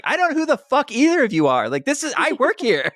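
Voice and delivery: high, fast exasperated mock rant; annoyed office worker. Office worker answering The Rock's phone, high exasperated mock rant. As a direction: vent like a fed-up worker on a crank call. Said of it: "It's like".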